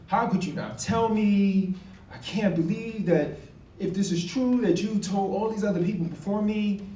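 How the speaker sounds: retold outburst at a friend; going off. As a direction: lay into your friend for keeping it from you. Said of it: "And I go off".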